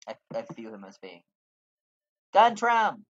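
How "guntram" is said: shouted name, pitched up; calling out loud. Acted. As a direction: call his name across the yard.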